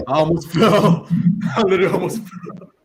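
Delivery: high, laughing disbelief. Real, not acted. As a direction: laugh through the words. Nearly fell, laughing it off.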